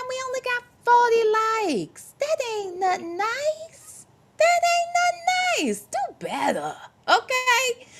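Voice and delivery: host scolding the chat for too few likes; sassy scold. Real, not acted. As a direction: needle the audience in a high sassy voice.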